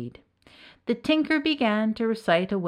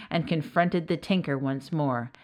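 ordinary delivery

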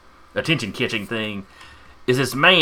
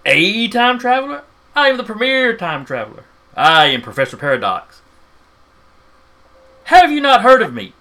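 higher, loud indignant madman; ranting mad professor; 10 semitones higher, 8 dB louder than usual. Acted. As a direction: rave like an offended mad scientist. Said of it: "ranting and raving like a madman".

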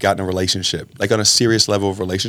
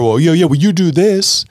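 defensive; 7 semitones higher, 4 dB louder than usual. Retold or imitated. Defensive scorekeeping reply to wife.

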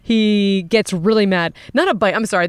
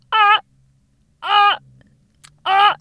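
high staccato barking calls; sea lion calls; 12 semitones higher, 5 dB louder, slower than usual. Retold or imitated. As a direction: bark like a sea lion, short and sharp. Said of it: "One of them was going like this".

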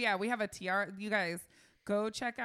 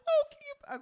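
high squeal; 7 dB louder than usual. Real, not acted. Squeals at cuteness.

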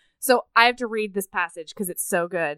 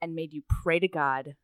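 soft dialogue reading, dropping low at the end; story narration; 3 semitones lower, 4 dB quieter than usual. A narrator's reading. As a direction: let the confession sink to a hush. Reads dialogue from the book.

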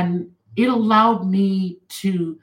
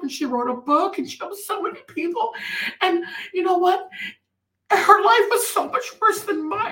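higher, tearful woman's voice, lightly imitated; tearful stranger; 9 semitones higher than usual. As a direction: voice the crying stranger, pitched up. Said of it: "And then she said".

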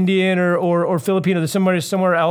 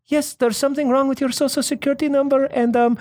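lightly imitated polite woman, pitched up; higher, pleasant phone-agent voice; nice lady caller; 7 semitones higher than usual. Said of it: "she sounded like such a nice".

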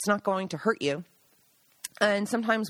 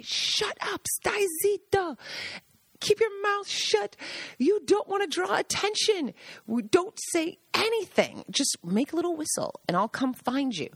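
much higher put-on voice, hushed scolding; Gaggi scolding; 9 semitones higher than usual. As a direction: voice the old Italian guide shushing them. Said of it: "and he would say".

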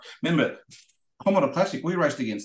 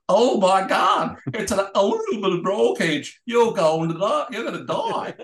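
hammed-up American accent, higher and slow, louder at the start; mock American accent; 7 semitones higher, 4 dB louder than usual. The American drivers warn about the roll cage in a mock American accent. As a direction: play the alarmed Americans in an exaggerated American accent. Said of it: "go in their American accent".

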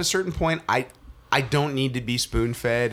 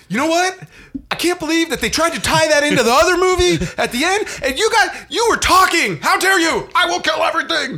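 much higher and louder, a hammed-up furious tirade; mocking angry rant; 6 dB louder than usual. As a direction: overdo a friend's outrage at the movie for laughs. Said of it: "you were like".

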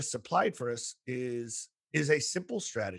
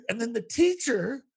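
ordinary talk, nothing marked

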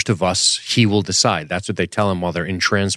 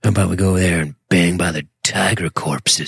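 sultry, lightly voiced; sexy voice. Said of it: "That's [name]' sexy voice".

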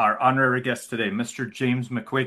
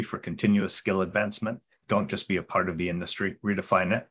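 polished promo voice; ad read; 3 semitones lower than usual. Acted. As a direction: read it like a radio ad. Ad read intro.

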